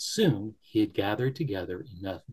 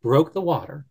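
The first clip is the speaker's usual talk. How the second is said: ordinary delivery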